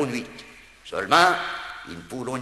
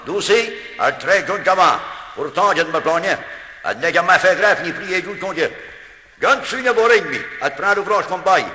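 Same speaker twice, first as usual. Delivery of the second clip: raised, higher voice in an indignant tirade; grumbling God the Father; 4 semitones higher, 4 dB louder than usual. Acted. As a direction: complain loudly about those never-content folk. (Dieu le Père qui rouspète, voix haute et forte)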